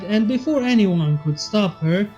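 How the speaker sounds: ordinary delivery, nothing marked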